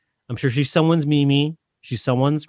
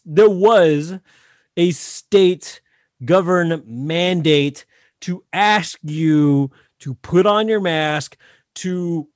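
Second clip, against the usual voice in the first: mock apologetic voice; slower than usual. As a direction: drag out a fake sorry. Mock restaurant staff apology.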